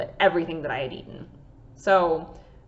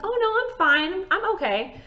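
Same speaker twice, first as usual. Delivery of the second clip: brushing it off; 12 semitones higher than usual. Retold or imitated. Brushing off professors' concern.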